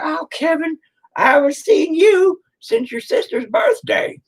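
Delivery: put-on very high, slow doddering old man voice; senile old man. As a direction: greet a stranger like a confused grandpa. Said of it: "you're like".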